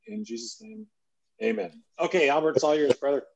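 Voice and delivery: ordinary talk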